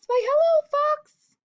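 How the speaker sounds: very high, loud mouse voice; high-pitched, bold little greeting. Acted. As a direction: call a squeaky hello.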